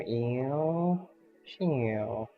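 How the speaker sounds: normal talking voice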